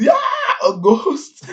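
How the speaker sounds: loud, high imitation of a frightened shriek; mock scared yell. Brook waking up scared among the crew, imitated yell. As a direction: yell it in hammed-up terror. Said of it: "He just yells".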